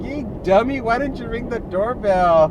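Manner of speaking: mock-angry self-rebuke; self-scolding. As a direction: tell yourself off, half laughing. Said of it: "I'm like".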